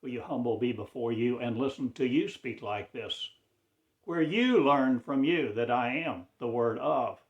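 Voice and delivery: ordinary talk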